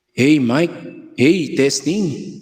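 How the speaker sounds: mic check with echo on it; echo effect. Shown as a demonstration. Sound tech demonstrating the mixer's echo, voice echoing. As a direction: test the mic through an echo. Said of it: "So, ito yung kanyang echo".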